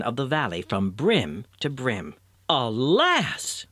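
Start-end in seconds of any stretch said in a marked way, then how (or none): 2.5-3.7 rising lament, higher on the stressed syllable; dismayed cry. A narrator's reading. Old couple finding their village gone, dismayed cry. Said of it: "cried these kind-hearted old people".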